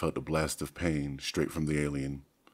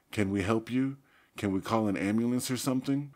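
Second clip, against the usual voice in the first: urgent; 6 semitones higher, 4 dB louder than usual. A narrator's reading. Marco offers help urgently.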